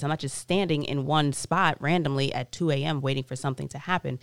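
ordinary delivery

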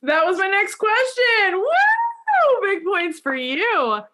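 high, loud delighted whoop of praise; excited cheer. Real, not acted. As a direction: cheer the guest on with a big woo. Host cheers guest's apology.

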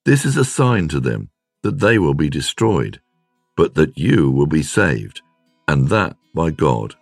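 ordinary talk, nothing marked

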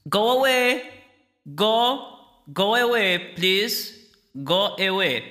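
high voice, spaced-out pleas; high, insistent disembodied plea; pleading spirit voice. Acted. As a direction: beg them to leave, high and drawn apart. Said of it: "voices echoing from the darkness".

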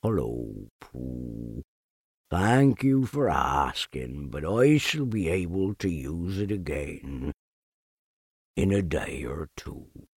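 deep, dragging, defeated donkey voice; gloomy Eeyore. Acted. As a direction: sigh each word out like a sad old donkey. Eeyore speaks.

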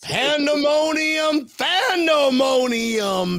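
drawn-out high fan cry; hype shout. Pretend. Podcaster hyping up Bills fans, drawn-out shout. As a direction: stretch each word long and high like an announcer.

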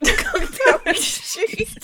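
slow, high laughing; cracking up. Real, not acted. Laughing at rat joke.

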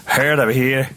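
garbled Irish-sounding take on Welsh; bad Welsh-turned-Irish accent. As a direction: badly imitate a thick Welsh accent. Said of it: "that's, like, Irish".

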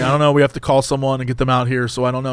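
low, flat drive-through worker; mild weary cashier voice; tired employee. Retold or imitated. Cashier answering angry customer, low and weary.

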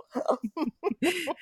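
quiet high-pitched giggle; a soft giggle fading out. Real, not acted. Laughing at the yogurt joke.

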